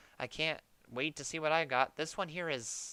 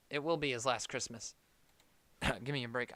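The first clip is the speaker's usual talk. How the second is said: ordinary talk, nothing marked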